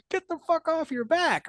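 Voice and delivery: half-laughing, telling him to get up; exasperated order. Retold or imitated. Exasperated order to wounded soldier.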